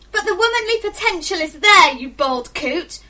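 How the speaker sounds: put-on loud, high teenage protest, dragging out the insult; outraged yell that slows on the last words; indignant yelling. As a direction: shout it at an annoying uncle. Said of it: "I feel like yelling at him".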